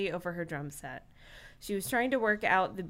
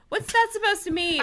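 high, sharp comeback in a pitched-up voice; indignant girl; 12 semitones higher, 4 dB louder than usual. Acted. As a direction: bristle back at the bandleader. Drummer hits back in the argument.

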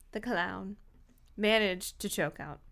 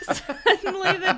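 laughing; 10 semitones higher, 7 dB louder than usual. Real, not acted.

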